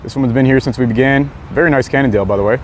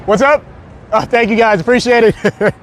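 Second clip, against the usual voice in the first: much higher, delighted greeting; excited greeting; 7 semitones higher, slower than usual. Real, not acted. Greeting waving viewers.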